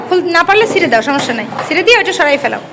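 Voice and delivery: ordinary talk, nothing marked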